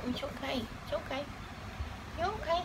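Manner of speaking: doting coo to a pet who won't be held. Real, not acted. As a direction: tease and cuddle a wriggling pet. Cuddling a squirming pet.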